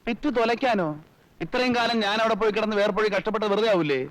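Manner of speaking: high-pitched, outraged objection; incredulous and aggrieved; indignant protest. Acted. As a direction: protest hotly, pitch held high throughout. Son protests selling the estate.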